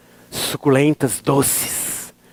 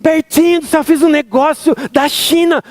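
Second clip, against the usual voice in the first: higher, thrilled mother bragging; mom's happy voice; 7 semitones higher than usual. Retold or imitated. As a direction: boast like a proud, happy mom. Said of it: "Era minha mãe quando ficava com voz de feliz".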